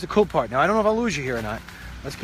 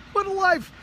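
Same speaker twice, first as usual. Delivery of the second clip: What a life in a much higher squeaky mock voice; mock duck voice; 14 semitones higher than usual. Repeat voicing the ducks.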